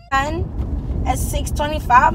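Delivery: six twenty-five stretched out slowly; slow, pointed restatement of six twenty-five; slow emphatic repeat. Shown as a demonstration. Repeats the time drawn out.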